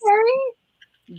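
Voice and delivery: high, loud sing-song hello; excited greeting. Real, not acted. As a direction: greet a friend with delight. Greeting a viewer.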